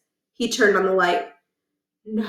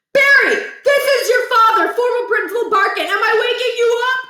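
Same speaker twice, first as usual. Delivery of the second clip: put-on high, loud old-man voice for the father; a cranky retired principal phoning his son; 14 semitones higher, 6 dB louder than usual. Scene: grandfather on phone waking his son, cranky old man voice.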